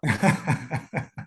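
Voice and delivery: a short, bright burst of laughter; amused laugh. Real, not acted. Laughing when the host teases him about his accent. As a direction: laugh it off, a bit embarrassed.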